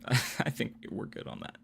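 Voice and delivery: laughing whisper. Real, not acted.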